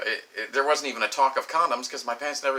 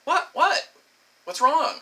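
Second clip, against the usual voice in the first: high, loud mock panic; alarmed; 12 semitones higher, 5 dB louder, slower than usual. Man asking his startled lover what is wrong, acted high alarm.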